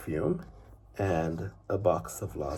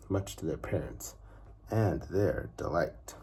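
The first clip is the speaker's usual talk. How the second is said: plain reading voice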